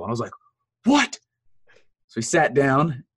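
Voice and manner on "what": much higher, sharp disbelieving "What?"; shocked. Retold or imitated. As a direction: jolt of disbelief. Told he'll meet Russell.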